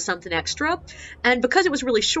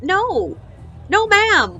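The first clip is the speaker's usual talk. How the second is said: firm refusal; 8 semitones higher, 4 dB louder, much slower than usual. Retold or imitated. Guide firmly refusing the woman.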